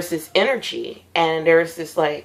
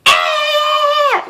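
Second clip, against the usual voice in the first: high, loud panicked scream; running-away scream; 3 dB louder than usual. Retold or imitated. Showing viewers how she runs from nightmares, high panicked scream. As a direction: shriek like you're fleeing a nightmare. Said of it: "Like, that's me".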